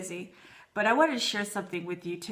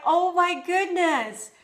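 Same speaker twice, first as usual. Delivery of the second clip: mildly gushing, higher, slow exclamation; retold surprise; 6 semitones higher, slower than usual. Wife retelling her reaction to Mother's Day flowers, mild surprise. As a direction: draw it out in touched surprise, pitched up.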